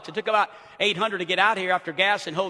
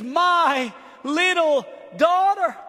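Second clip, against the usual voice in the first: pleading father; 5 semitones higher, 4 dB louder, much slower than usual. Acted. Preacher voices Jairus pleading.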